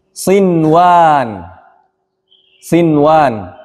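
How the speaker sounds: "sinwan" with clear n before w and drawn-out ending; unmerged nun. Shown as a demonstration. Teacher giving an example of no merging, clear nun. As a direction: sound the n in "sinwan", then stretch the last vowel.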